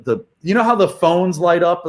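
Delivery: usual speaking voice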